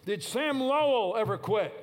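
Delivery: high, loud coach's challenge; coach shouting. Retold or imitated. Coach challenging his players in the locker room, shouting. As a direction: fire the question at the team like a coach. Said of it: "And then he asked".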